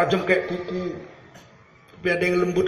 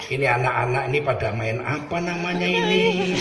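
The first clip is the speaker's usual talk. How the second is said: gentle kiai question; 6 semitones lower, 4 dB quieter than usual. Retold or imitated.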